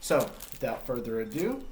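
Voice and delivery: plain reading voice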